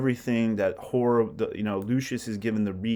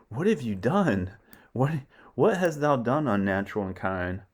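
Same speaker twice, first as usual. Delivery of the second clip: raised, alarmed stage question; shocked outcry; 3 semitones higher, 3 dB louder than usual. Retold or imitated. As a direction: cry out in horror. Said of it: "And they're like".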